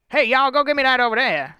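sloppy casual talk; casual drawl. Acted. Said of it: "you're not saying".

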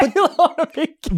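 high, fast mock Dracula voice with a laugh; laughing through a put-on vampire accent. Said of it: "it's a different accent".

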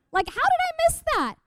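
high, quick squeal of disbelief; astonished realization. Retold or imitated. Speaker retells reading Ephesians, high incredulous astonishment.

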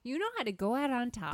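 hammed-up Irish lilt, rushed; terrible Irish accent. Host offers the better phrase in a bad Irish accent. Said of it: "That is a terrible Irish accent".

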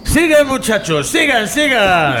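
put-on high, drunk, egging on invisible friends; delirious cheering. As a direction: cheer on friends who are not there, up high. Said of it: "Deja de delirar". (ánimo delirante de borracho, muy agudo)

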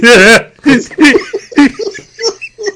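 loud high laugh; real delighted laugh; high burst of laughter. Real, not acted. Laughing at cat name.

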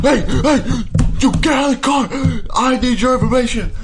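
high, loud mock outrage; hammed-up angry yelling. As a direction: imitate a drunk man shouting after a car. Johnny yelling at driver through window.

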